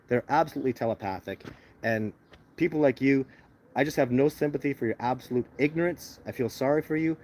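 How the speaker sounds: usual speaking voice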